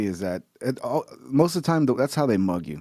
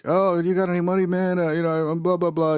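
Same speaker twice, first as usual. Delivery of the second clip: higher whiny panhandler plea; 7 semitones higher than usual. Retold or imitated. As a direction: play a pleading beggar, pitched up. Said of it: "and he's like".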